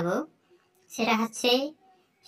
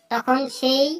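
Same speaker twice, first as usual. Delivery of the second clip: ordinary talk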